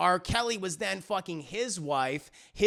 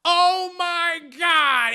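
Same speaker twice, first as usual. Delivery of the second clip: drawn-out, high, loud gasp of disbelief; mock shock, stretched out slowly; shocked reaction; 10 semitones higher, 9 dB louder, much slower than usual. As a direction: act out a loud, scandalized "oh my God". Retold shocked reaction of Rosie.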